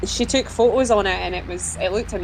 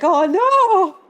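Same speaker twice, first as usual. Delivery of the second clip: high, loud, drawn-out "oh no"; mock dismay; 11 semitones higher, 6 dB louder than usual. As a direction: wail it as an exaggerated group reaction. Retelling their dismayed group reaction.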